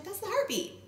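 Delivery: high, light announcement; cheerful sonographer. Retold or imitated. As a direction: tell the news brightly.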